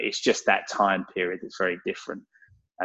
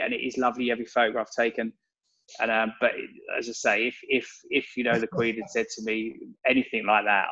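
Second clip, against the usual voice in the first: ordinary talk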